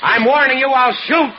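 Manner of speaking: very high and loud threat; threatening shout. Acted. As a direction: bark the warning. Husband threatens.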